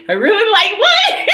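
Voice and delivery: rising high "what?" with a laugh; incredulous what. Real, not acted. Repeating her compliment, laughing disbelief. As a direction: throw it back in disbelief. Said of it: "mocking the compliment".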